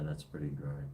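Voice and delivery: usual speaking voice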